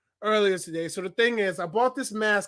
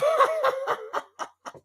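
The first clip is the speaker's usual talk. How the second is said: shrieking laugh. Pretend. Mascot cracking up at own joke.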